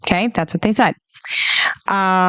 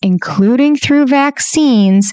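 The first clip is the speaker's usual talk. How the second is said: slow emphatic; 3 semitones higher than usual. Shown as a demonstration. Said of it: "go with me on this here".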